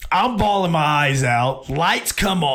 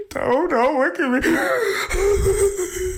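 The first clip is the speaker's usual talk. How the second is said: very slow, much higher tearful whine; mock sobbing plea; 13 semitones higher, much slower than usual. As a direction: whimper it through fake tears. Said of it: "and I'm just like".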